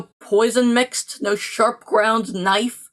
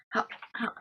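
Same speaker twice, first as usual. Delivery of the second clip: whispered; 14 dB quieter than usual. Acted. Romeo frantic over Juliet.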